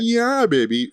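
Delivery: lightly mimicked film catchphrase; Austin Powers catchphrase. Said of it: "that whole sort of".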